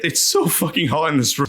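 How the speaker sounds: normal talking voice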